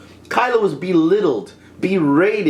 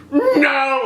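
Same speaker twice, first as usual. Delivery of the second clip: high, drawn-out comic howl; hammed-up high mock scream; Muppet-like wail; 8 semitones higher than usual. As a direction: mimic a puppet-faced cry of horror. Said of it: "it looked like a Muppet for a moment".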